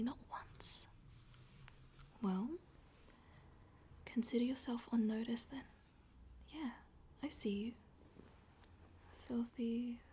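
soft taunting whisper; teasing whisper. Acted.

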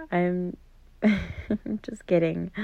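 slow, laughing through the words; laughing tease. Acted. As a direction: break into a chuckle mid-sentence. Teasing, then laughs it off.

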